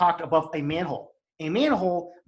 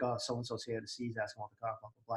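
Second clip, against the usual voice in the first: hushed, low murmur into the radio; retold low murmur; 5 semitones lower, 14 dB quieter, faster than usual. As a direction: keep it low so the caller can't hear. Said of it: "He gets real low".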